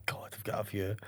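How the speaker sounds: breathy whisper to himself; whispered aside. Real, not acted. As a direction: murmur it under your breath, unvoiced. Thinking of barber stories.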